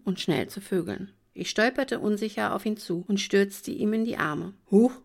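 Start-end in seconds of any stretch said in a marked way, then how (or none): 4.7-4.9 mild surprised huch. Acted. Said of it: "machte er".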